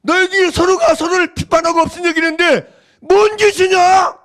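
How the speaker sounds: the apostle rebuking the quarreling church; Paul rebuking. Retold or imitated. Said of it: "바울이 얘기하는 거예요".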